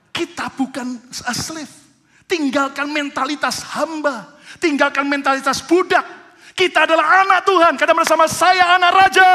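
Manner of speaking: loud, high, driving sermon delivery; full-force preaching. Acted. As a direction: preach it with rising fire to the congregation. Climactic preaching, congregation call.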